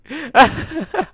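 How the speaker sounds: loud, high giggles; high-pitched laughing fit. Real, not acted. Player cracking up at broken textures, high laughter.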